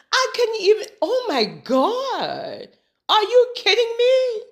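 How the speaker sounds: high, loud, drawn-out incredulity; amused disbelief. Real, not acted. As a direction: exclaim in scandalized, laughing disbelief. Reacting to the demonic dragon screen.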